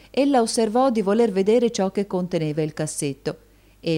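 ordinary delivery

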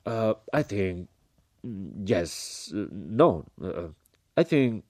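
halting, dithering non-answer; flustered hesitation. A narrator's reading. General cornered by the woman's question, flustered. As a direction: waver with long uncertain gaps. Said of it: "rispose il generale Cork battendo le ciglia".

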